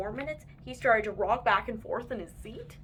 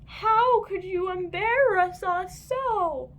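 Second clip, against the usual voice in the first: slow, high, louder wail; yelled back; 9 semitones higher, 6 dB louder, slower than usual. A narrator's reading. Moms yell back at sons from the trash can, slow wailing. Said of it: "they yelled back".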